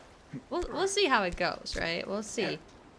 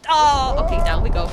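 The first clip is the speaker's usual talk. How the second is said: loud startled exclaim; 4 semitones higher, 12 dB louder than usual. Real, not acted. Falls down.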